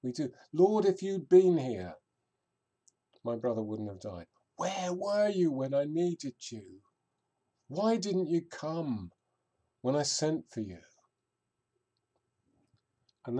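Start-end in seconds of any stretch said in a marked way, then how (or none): 0.5-4.3 a grieving sister accusing her friend; anguished reproach. Acted. Preacher voices grieving Martha's reproach. 4.6-10.8 begging to know why he stayed away; pleading complaint. Acted. Voicing Martha's complaint to Jesus.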